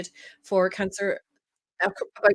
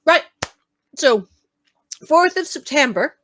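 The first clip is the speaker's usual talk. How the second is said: talking through marzipan; words round a mouthful; mouth full; 2 semitones higher, 7 dB louder than usual. Real, not acted. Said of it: "didn't talk with your mouse full".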